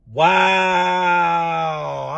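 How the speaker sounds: one long, held-out wow; drawn-out disbelief. Retold or imitated. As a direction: stretch the word out in mock amazement. Mock reaction to teacher's tattling.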